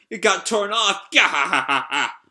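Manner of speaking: jeering line into a hammed-up cackle; mocking laughter. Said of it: "like, laughing at her".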